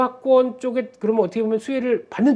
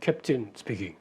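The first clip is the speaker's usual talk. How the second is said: slow, low airline captain announcement; pilot cockpit headset; 3 semitones lower, much slower than usual. Retold or imitated. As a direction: drawl it like a pilot on the intercom. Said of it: "그 기장이 방송하는 거 아시죠?".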